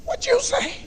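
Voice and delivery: very high, squeaky mock outrage; mama's high challenge. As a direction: dare him to repeat it, way up high. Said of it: "your mama say".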